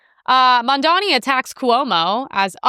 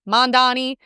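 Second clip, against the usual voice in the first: usual speaking voice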